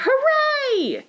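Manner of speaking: high joyful hooray; penguin cheer. Acted. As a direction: cheer out loud. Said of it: "Penguin shouted".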